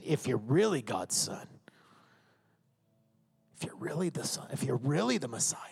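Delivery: low, soft, needling doubt; slowed, hushed questioning of a claim; insinuating accusation. Acted. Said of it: "'cause there were questions of accusation".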